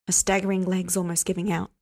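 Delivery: plain reading voice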